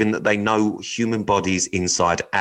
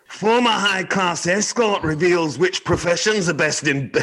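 bad Scottish accent, high and slow; 8 semitones higher than usual. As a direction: attempt a Scottish newsreader voice. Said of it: "I'd like you to try a Scottish accent".

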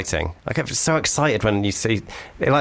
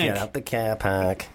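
soft, slow Manchester echo; Manchester accent; 5 dB quieter, slower than usual. Retold or imitated. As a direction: draw it out quietly in Manchester vowels. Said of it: "That sounded like Manchester at the end".

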